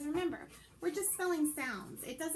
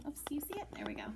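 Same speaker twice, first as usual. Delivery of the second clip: ordinary talk, nothing marked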